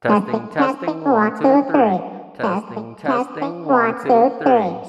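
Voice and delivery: very high pitch-shifted voice; squeaky baby voice. Shown as a demonstration. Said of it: "the one before that is the baby voice".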